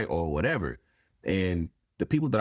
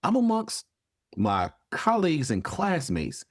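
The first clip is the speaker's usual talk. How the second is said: much higher, indignant disbelief; incredulous protest; 13 semitones higher than usual. Retold or imitated. Student protesting to a professor who banned cussing, incredulous. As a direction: push back in high-pitched, put-on astonishment.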